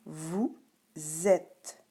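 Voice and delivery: "Vous", pause, then "êtes" said zèt; liaison. Shown as a demonstration. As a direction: stress the z that opens "êtes". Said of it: "Écoute bien".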